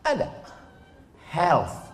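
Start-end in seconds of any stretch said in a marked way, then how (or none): 1.3-1.8 Health said with a clear English th at the end, helth; th sound. Shown as a demonstration. Preacher shows the congregation the th in Health. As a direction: end Health on the tongue-between-teeth th. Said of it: "Ada huruf tha dalam bahasa Inggris".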